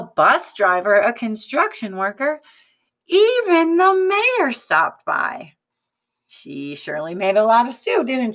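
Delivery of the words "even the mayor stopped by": high, loud, slow wonder; storyteller's wow; impressed reveal. A narrator's reading. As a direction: make the mayor a big surprise. Building list climax.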